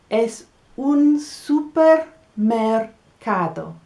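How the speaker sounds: slow, careful model phrasing; slow model answer. Shown as a demonstration. Teacher modelling the word slowly for learners. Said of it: "Do you know the word for supermarket".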